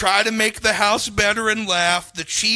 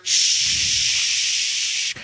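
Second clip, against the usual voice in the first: unvoiced shhh of the tap; imitated water hiss. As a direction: breathe out a sink's shhh. Imitating the kitchen water sound.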